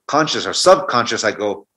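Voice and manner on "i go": usual speaking voice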